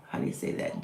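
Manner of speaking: breathy whisper to herself; whispered aside. Real, not acted. Reader checks a name, whispering it to herself.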